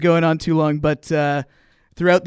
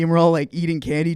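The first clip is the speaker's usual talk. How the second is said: usual speaking voice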